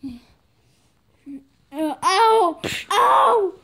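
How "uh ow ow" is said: loud, high yelps of pain. Acted. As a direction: cry out in hammy pain. Treated patient cries out.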